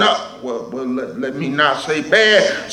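dropped soft self-correction; aside. Real, not acted. Preacher correcting himself to the church, softly. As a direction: take it back under your breath.

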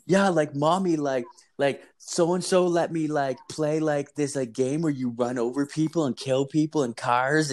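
high-pitched imitation of a small child; little kid tattling. Kid tells mom about the violent game, tattling. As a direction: mimic a kid telling on someone to mommy. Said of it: "the kid's just like".

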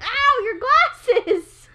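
slow, loud pained ow; sudden ow. Real, not acted. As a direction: yelp at the bump. Glasses hit while tickling.

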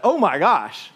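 a loud, laughing burst of delight; a loud, happy "oh my gosh" with a laugh; excited gasp. Retold or imitated. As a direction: blurt it out, thrilled to hear home. Hearing an American voice abroad.

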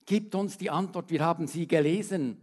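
usual speaking voice

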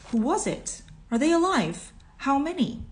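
high, urgent questions; excited crowd. A narrator's reading. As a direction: clamour for news like bystanders. Crowd calling out questions, acted.

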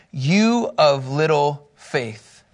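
very slow and low, each word weighed; "you of little faith" stretched out, slow and solemn; drawn-out rebuke. Acted. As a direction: let the rebuke sink in slowly. Preacher quotes Jesus, drawn out.

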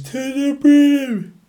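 very high, slow, drawn-out "To the brim"; TikTok guy impression. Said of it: "that guy on TikTok that says".

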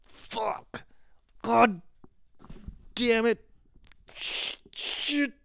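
hushed, high-pitched panicked curses under the breath; panicked swearing. Retold or imitated. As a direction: swear in a breathy, frightened hush. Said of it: "I got really scared".

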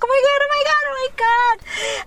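very high squealed repetition; super excited freak-out. Retold or imitated. Reliving the singer holding her hand, super excited squeal. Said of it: "so super excited, screaming, jumping around".

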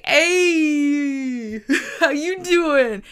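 high, slow imitated hello breaking into laughter; mock excited greeting. Friends greeting the shop guys back, mock excited. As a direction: sing out a hello to welcoming acquaintances.

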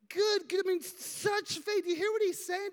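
usual speaking voice

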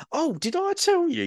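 very high, bright mock old lady; chatty lady's sudden recall. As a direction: squeak it out like she just remembered. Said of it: "she'd go".

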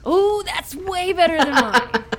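delighted laughing praise. Real, not acted. Praising Chelsea's accent, laughing. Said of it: "laughing reaction".

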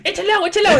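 high, loud, laughing mock scold; mock scolding mom. Imitating the friend's mom scolding kissing couples, laughing loudly. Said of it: "decía".

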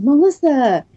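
very high, effusive imitation of a greeter; imitating an over-friendly acquaintance; friendly lady's greeting. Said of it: "a lady walked up to me after the meeting and said".

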